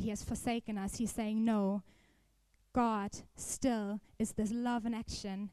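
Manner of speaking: ordinary delivery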